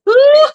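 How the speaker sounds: high wailing boo-hoo sobs; imitating a child's dramatic wailing; mock boo-hoo cry. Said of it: "boo-hoo crying".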